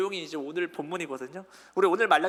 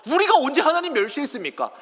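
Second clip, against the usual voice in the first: louder, higher mock protest; defiant teenage retort; 6 semitones higher, 6 dB louder than usual. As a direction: argue back like a teenager with mom. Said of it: "청소년 사춘기의 딸이랑 엄마랑 싸우는 느낌". (사춘기 딸처럼 대들며)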